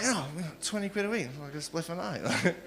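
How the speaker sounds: very soft, quick mumble; mock-sheepish murmured confession; meek mimicry. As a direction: mumble it timidly, barely audible. Mimicking a timid group member.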